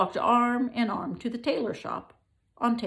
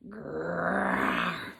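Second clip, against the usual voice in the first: a lion growling at the tailors. Acted. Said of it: "said the lion".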